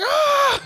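high laughing shriek; mock scream. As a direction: yelp in fake horror. Character screams at split dog.

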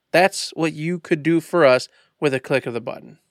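ordinary talk, nothing marked

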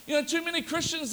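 usual speaking voice

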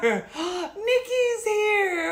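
high, loud gasp of surprise; delighted gasp. Real, not acted. Sister suddenly joins the stream.

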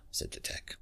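plain reading voice

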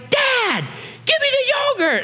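very high-pitched mock child's demand; shriek-like squeal at no extra volume, imitating a hangry kid; hangry kid tantrum. Hangry daughter demanding yogurt from dad, mock tantrum. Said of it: "they might be kicking and screaming".

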